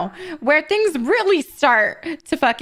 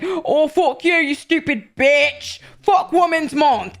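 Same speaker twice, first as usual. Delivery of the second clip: mock British accent; 5 semitones higher, slower than usual. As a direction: put on a British man's accent and jeer. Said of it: "random British men that are being like".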